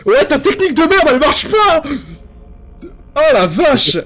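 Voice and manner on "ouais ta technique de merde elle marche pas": high excited yell. Real, not acted. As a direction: shout it out laughing at him. Yelling at friend after jumpscare.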